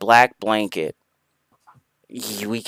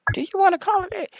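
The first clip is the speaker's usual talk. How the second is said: high teasing; 5 dB quieter, much faster than usual. Acted. Teasing question.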